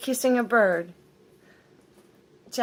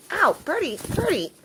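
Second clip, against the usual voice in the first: startled ow; 9 semitones higher, 6 dB louder than usual. Real, not acted. Bird bites her.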